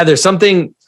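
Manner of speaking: ordinary talk, nothing marked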